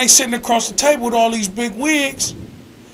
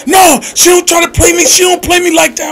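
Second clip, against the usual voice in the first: raised, heated voice acting out Robin; retold angry rant; 5 semitones higher, 4 dB louder than usual. Coworker mimicking an angry friend, heated and raised. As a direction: rant about the girl who's trying to play her. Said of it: "I'm like".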